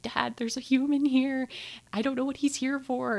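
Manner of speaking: imitating herself anxiously calling her dad; panicked call to dad.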